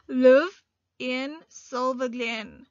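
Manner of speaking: very slow, word by word; slow dictation read. Shown as a demonstration. Teacher dictating the rest of the sentence, very slow. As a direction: spell it out slowly for the class. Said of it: "We continue with our sentence".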